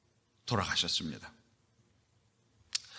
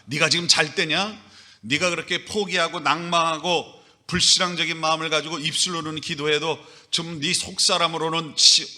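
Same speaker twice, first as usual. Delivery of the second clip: louder, higher scolding that peaks in a shout; angel scolding; 5 semitones higher, 4 dB louder than usual. Acted. Angel scolding the sleeping Peter in prison, loud and higher. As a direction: rebuke him hard, rising to a yell. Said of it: "이렇게 야단을 치시는 겁니다".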